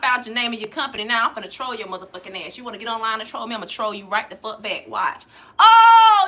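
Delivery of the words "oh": much higher, much louder exclamation; gleeful oh. Real, not acted. Catching the laughing troll, loud gleeful oh. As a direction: burst out in triumph.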